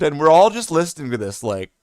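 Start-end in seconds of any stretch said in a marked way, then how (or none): none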